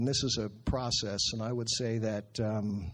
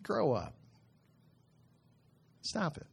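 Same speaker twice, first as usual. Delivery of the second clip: scolding himself; 8 semitones higher, 3 dB quieter than usual. Retold or imitated. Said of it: "and I said".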